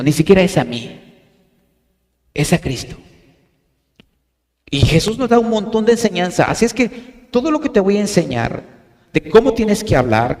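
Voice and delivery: ordinary delivery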